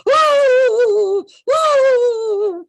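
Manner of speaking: high, loud crying moans; ghostly wail. Retold or imitated. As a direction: keep wailing like the ghost. They kept hearing it.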